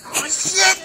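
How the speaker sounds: high startled yelp; shocked high cry; startled shout. Real, not acted. Startled by friend waking.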